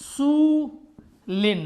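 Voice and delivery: slow drawn-out रसूलिन, each letter clear; Quranic recitation. Shown as a demonstration. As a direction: recite रसूलिन slowly with kasra tanween. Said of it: "कुरानी मिसालों की तरफ तवज्जो कीजिए". (धीमे तिलावत अंदाज़ में रसूलिन)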